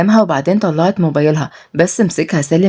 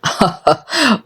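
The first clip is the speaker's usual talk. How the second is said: short villain's laugh; mocking laugh; 3 semitones higher than usual. Boss laughs at the crying niece, mocking. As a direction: laugh scornfully at their pleas. Villain mocks pleading girls.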